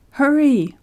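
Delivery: sharp urgent high cry; panicked shout to hurry; hoarse cry. A narrator's reading. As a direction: cry out in desperate haste. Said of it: "he cried hoarsely".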